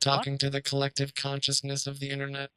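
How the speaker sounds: flat, mechanical robot voice. Acted. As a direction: intone it like a machine. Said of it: "Now you're talking like a robot?".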